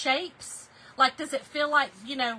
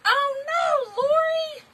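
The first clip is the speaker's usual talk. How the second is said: excited squeal; 9 dB louder than usual. Real, not acted. Reacting to viewers' comments.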